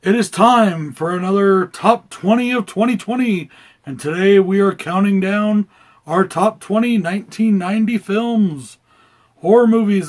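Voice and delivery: booming ring announcer intro. Acted. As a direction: announce it like a title fight. Boxing announcer style countdown intro.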